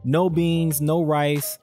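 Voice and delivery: usual speaking voice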